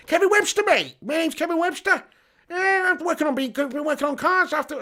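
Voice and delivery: very high comic soap-mechanic impression; badly faked, high-pitched funny character voice; Kevin Webster. Streamer introduces himself as Kevin Webster, high mock impression. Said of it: "I can't do his accent".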